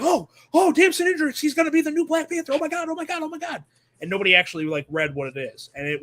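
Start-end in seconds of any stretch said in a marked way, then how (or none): 0.0-3.6 much higher, giddy mock fan squeal; fast, high, breathless fake fan excitement; gushing fan impression. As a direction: imitate fans losing it over the news. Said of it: "immediately were like".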